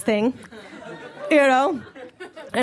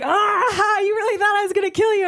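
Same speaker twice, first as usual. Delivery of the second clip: high, mock-hysterical cackling voice; imitated laughing; 8 semitones higher than usual. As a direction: imitate a creepy man cracking up as he asks. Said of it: "he starts laughing".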